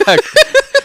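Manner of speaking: shrieking laugh; high shriek laugh. Real, not acted. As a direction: burst into a squeal. Burst of laughter.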